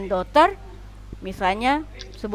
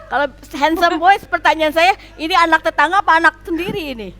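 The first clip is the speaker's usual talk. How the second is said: louder, higher, fast teasing banter; playful teasing; 7 semitones higher, 6 dB louder than usual. Real, not acted. Teacher teasing a student about her handsome sons, loud playful ribbing. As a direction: tease her with a grin and rising voice.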